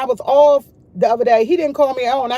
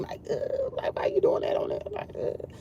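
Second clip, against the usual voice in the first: disgusted ugh; 11 dB quieter than usual. Real, not acted. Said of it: "burping is so disgusting".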